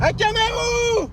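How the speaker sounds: high, drawn-out call across the woods; long high hail to a lost dog. Real, not acted. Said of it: "ça fait une heure que je crie".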